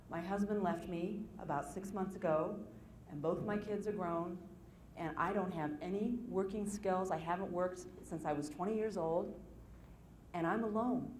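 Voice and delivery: soft, subdued confession; retold tearful caller. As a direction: quietly imitate a caller close to tears. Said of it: "she started to cry".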